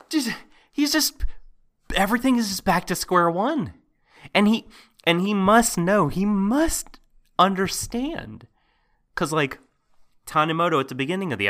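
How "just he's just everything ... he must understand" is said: exasperated. Real, not acted. Exasperated at the character.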